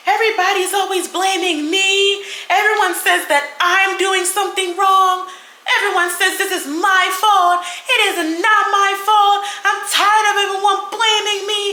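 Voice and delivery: high, whiny mock sobbing complaint; mock bawling. Mocking Big B bawling at the party, high mock wail. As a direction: ham up a crybaby wail. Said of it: "boo-hoo bawling".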